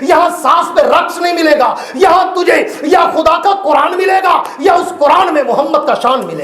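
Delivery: high, loud, rising sermon shouts; fiery preaching. Acted. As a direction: thunder each line at the crowd. Preaching at full force. (ऊँची आवाज़ में जोशीली तक़रीर)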